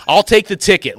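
I'll take the ticket, high and loud, flung out; mad defiance. Retold or imitated. Defying the old man about the ticket, loud and high. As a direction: throw it at him defiantly, pitch way up. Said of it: "Just mad".

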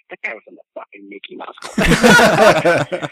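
loud, slow, laughing jab; laughing outburst. Real, not acted. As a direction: bellow it through laughter to mock. Mocking Gosling's tough image.

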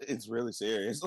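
low, flat and indifferent; deadpan, like nothing happened; monotone voice. Retold or imitated. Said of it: "fucking monotone voice".

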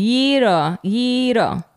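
"Gyro" with Y, long ee and tapped r; Greek pronunciation of gyro. Shown as a demonstration. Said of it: "How do you say euro in Greek".

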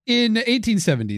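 pitched well up to begin over; emphatic restart. Real, not acted. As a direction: relaunch the sentence high and deliberate. Restarting the sentence after the slip.

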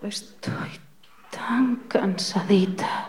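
slow, fading whisper. Pretend. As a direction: barely breathe the words out. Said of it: "le dirás bajito". (susurro lento y apagado)

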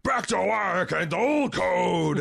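loud slow mock gravel growl; gravelly movie voice. Mocking Quaid slipping back into his gruff voice. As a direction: drag it out like a gruff knight. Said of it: "British vowel sounds".

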